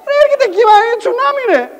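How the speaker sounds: very high, alarmed acted plea; desperate warning. Warning a know-it-all of tsunami, desperate. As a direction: insist in a high-pitched panic. Pleading alarm to disbelieving friend.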